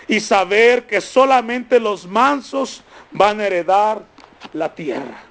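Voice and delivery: oración en voz alta, más aguda y sostenida; oración pastoral. Real, not acted. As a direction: ora con fervor, frase por frase, con pausas. Ora en voz alta por la congregación. (oración ferviente por la congregación)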